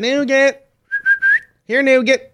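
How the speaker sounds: high call across the house; calling the dog. Real, not acted.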